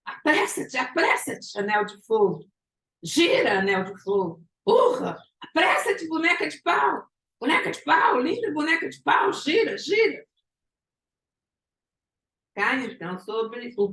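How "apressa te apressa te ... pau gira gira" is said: high, urgent mock shouts; excited, high-pitched hammed-up yelling; acted shouting. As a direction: cry out frantic commands in a raised pitch. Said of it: "parece que ele fala gritando assim".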